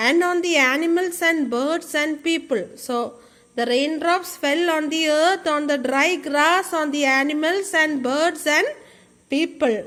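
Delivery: ordinary delivery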